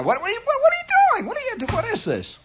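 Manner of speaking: very high, sputtering nag; mock scolding. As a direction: stammer it out in high-pitched mock alarm. Mock scolding of those looking.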